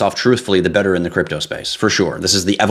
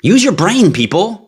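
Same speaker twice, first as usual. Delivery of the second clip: higher, louder, fed-up yell; exasperated shout; 10 semitones higher, 3 dB louder than usual. Real, not acted. Fed up streamer yells at viewers, exasperated. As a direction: shout the plea at the crowd. Ranting at scam victims.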